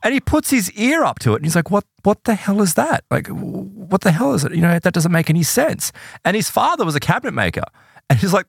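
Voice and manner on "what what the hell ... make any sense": higher-pitched, baffled quote; mildly incredulous, pitched up; retold bafflement. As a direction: act out someone puzzled by an impossible sound. Retold bafflement at hidden bell.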